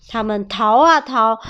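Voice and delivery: ordinary delivery, nothing marked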